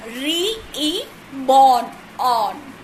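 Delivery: "re-e-born, on" with each vowel repeated alone; sounding out syllables. Shown as a demonstration. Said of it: "So two syllable".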